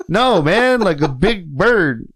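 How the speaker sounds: high, loud protest, then drawn out; retold frustrated correction; exasperated insistence. Insisting in retold exchange with trainer.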